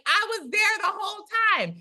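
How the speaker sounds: indignant cry, pitch shooting far up; angry protest. Retold or imitated. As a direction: protest the injustice, voice jumping high. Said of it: "she's angry because she's like".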